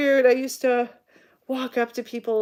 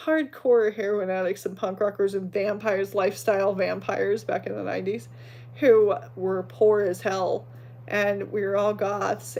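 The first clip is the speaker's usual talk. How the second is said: ordinary delivery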